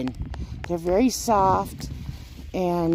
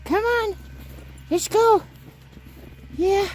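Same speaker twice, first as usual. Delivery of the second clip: calling the dogs; 13 semitones higher than usual. Real, not acted. Calling the puppies.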